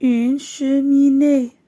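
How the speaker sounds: slow, loud and clear for the class; slow model reading. Shown as a demonstration. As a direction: spell it out slowly to pupils. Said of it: "teacher models word slowly". (lecture lente et forte pour la classe)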